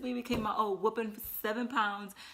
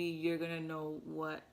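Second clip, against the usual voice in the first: normal talking voice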